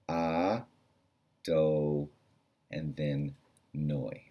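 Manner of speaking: proper pronunciation, gently spaced; slow syllables. Shown as a demonstration. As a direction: draw out "Ah, do, noi" one by one. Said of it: "That is the proper way to pronounce it".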